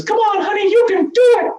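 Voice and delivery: very high, squeaky cartoon-mouse cheer; Minnie Mouse voice. Wife cheering her husband on from below, squeaky Minnie Mouse voice. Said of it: "she sounded like Minnie Mouse".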